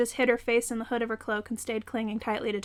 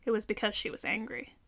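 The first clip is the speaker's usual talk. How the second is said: plain reading voice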